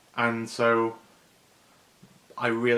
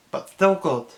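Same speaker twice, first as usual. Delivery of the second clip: halting, higher, aching alien voice; heartfelt Stitch; 6 semitones higher, 5 dB louder than usual. Retold or imitated. Stitch telling the councilwoman about his family, aching. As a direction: plead it with heartache. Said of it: "with the heartache".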